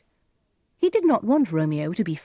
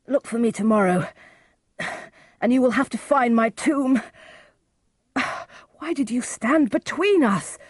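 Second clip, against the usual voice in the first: high strained voice with sighing breaths; wounded, dying; 7 semitones higher than usual. Acted. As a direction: gasp out reproaches as a badly hurt man. Wounded Mercutio gasps last words.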